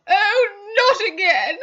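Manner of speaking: high, loud wail of not again with a crying break; sobbing. Acted. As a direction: let the tears come into the words. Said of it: "Missus Carter began to sob".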